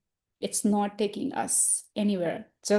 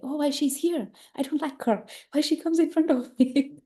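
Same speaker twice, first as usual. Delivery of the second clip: higher-pitched grudging whine, playfully acted; mock annoyed complaint; 6 semitones higher than usual. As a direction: complain as if dreading seeing her. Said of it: "we feel like".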